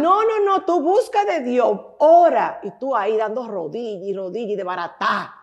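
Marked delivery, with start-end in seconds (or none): 0.0-2.6 high, loud preachy "just pray"; hammed-up sanctimonious nagging; preachy scolding relatives. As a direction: lecture her as a pushy churchgoer would. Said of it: "Y dije".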